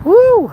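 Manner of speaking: loud, high whoop. Real, not acted. Tired walker on the hill, loud high whoop. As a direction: let out a high "Woo". Whoops while struggling uphill.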